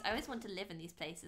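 ordinary talk, nothing marked

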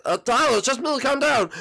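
high, flustered appeal; alarmed pleading. Acted. Bowser Junior calming furious Tyler, alarmed.

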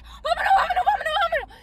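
high, loud frantic urging; panicked hurry. Retold or imitated. Mabel urging friends to flee the club, high frantic shout.

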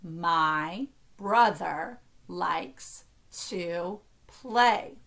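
very slow, each word set apart. Shown as a demonstration. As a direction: stretch every word and pause between them. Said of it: "do that one again".

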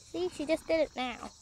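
ordinary delivery

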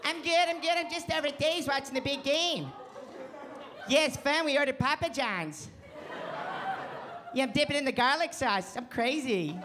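put-on sappy phone voice for the girlfriend. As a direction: coo at her like a Muppet. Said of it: "really bad girlfriend voice".

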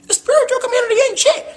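high squeaky mock of the haters, rising at the end. Vlogger mimicking outsiders dissing the spiritual community, in a squeaky high voice.

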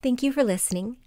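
plain reading voice

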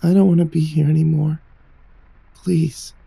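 a touch of quiet tearful begging; understated frightened pleading; tearful plea. Acted. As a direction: plead softly, close to tears. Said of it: "after another sniffle".

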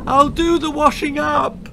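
high, loud anxious bleat. A narrator's reading. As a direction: bleat it high, desperate to appease. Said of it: "she bleated".

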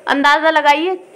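ordinary talk

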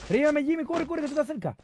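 high urgent pleading; panicked plea for help; being chased. Real, not acted. Downed, begging teammate to revive.

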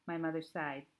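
ordinary delivery, nothing marked

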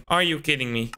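normal talking voice

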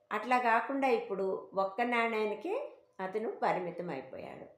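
plain reading voice

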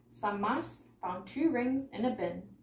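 ordinary delivery

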